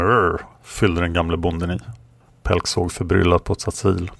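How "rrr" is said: a wolf's throaty rrr; wolf growl. Acted. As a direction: growl it out like an animal. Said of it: "Tillsammans med".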